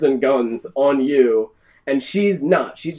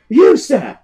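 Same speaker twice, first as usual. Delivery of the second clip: high, loud captain's hail; single someone out with a ringing cry; commanding call; 7 semitones higher, 9 dB louder than usual. Acted. Captain points at the swimmer, commanding call. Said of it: "at you and goes".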